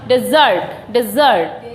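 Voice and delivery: Desert with the weight on "ZURT"; de-ZURT, second half of desert stressed. Shown as a demonstration. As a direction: hit the z syllable of desert, pitch falling. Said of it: "ज पे stress दिया जाएगा".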